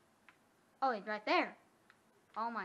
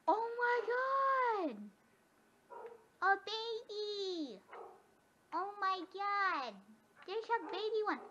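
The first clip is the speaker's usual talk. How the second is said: much higher, drawn-out squeal; squealing with delight; 9 semitones higher, 4 dB louder, slower than usual. Real, not acted. Gamer gushing over a baby wolf, high slow squeal. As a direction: stretch every word in a high delighted squeal.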